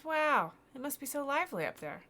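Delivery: high, loud wonder; amazed boy. Acted. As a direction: marvel out loud like an excited boy. Boy amazed at lighthouse story.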